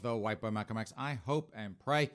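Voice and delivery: usual speaking voice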